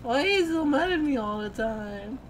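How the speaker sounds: hammed-up plaintive complaint; drunken whining. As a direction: whine it like a hurt drunk. Drunk friend whines at the other.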